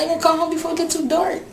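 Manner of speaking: put-on high, quick little girl's voice; imitating her daughter. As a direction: rush it like an anxious small child. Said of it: "She said".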